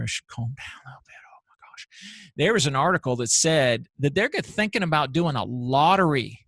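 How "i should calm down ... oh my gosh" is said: real hushed whisper to cool off; whispered self-calming aside; calming himself down. Real, not acted. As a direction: drop to a whisper to settle down. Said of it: "I'm getting a little, little fired up here".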